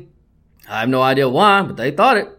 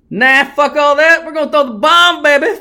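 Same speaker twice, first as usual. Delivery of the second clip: mock play call; 7 semitones higher, 4 dB louder than usual. Mocking the team's reckless call.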